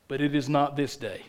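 dropped low and quiet; sudden hushed resolve; king's battle speech. Acted. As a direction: hold the troops with a soft, low refusal. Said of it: "He rides out in front of them and says".

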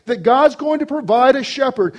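loud, high, ringing proclamation; full-force preaching. Real, not acted. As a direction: drive it home to the whole congregation.

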